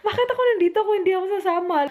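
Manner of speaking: crying out she wants to go with her sick husband; tearful pleading. Real, not acted. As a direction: plead to go along, near tears, words tumbling out. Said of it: "iyak ako na iyak".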